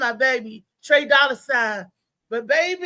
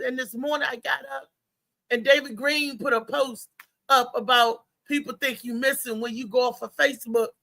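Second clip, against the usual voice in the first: ordinary talk, nothing marked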